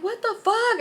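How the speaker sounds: high-pitched alarmed cry; mock scream of disbelief; retold scream. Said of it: "So I'm screaming".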